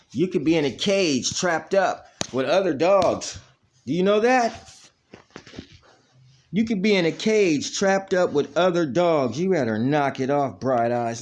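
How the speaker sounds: high, loud mock scolding; scolding the dog. Real, not acted.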